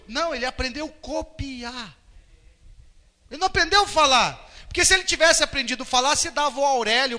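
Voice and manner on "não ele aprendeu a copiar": ordinary delivery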